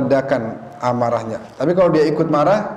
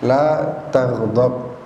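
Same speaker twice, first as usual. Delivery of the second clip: very slow, drawn out La taghdab; slow solemn Arabic; 3 semitones lower, much slower than usual. Retold or imitated. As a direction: lay each syllable of La taghdab down slowly. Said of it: "Nabi cuma bilang gitu dong". (pelan dan khidmat: La taghdab)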